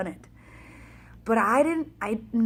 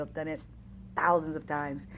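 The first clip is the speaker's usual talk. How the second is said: ordinary delivery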